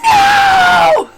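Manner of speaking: high, loud screamed No; screaming no. Retold or imitated. Gamer sees her favorite game taken, shrieking. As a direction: shriek it like your favorite game was stolen. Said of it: "I screamed at the top of my lungs".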